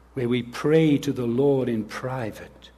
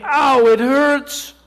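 high, loud, drawn-out pained wail; hurt child's cry; 13 semitones higher, 10 dB louder, slower than usual. Pretend. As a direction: cry out like a kid with a bleeding knee. Child hurt after bike fall.

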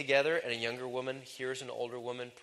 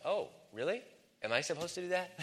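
softer, higher innocent question; surprised younger woman; 5 semitones higher, 5 dB quieter than usual. Acted. Younger woman answering older woman, mildly surprised. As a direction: ask it softly, pitched up in mild surprise. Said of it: "the younger woman's like".

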